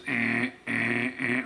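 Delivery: droning "eh" machine alert; imitated alarm buzzer. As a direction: mimic a scanner going off. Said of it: "a noise that would get your attention".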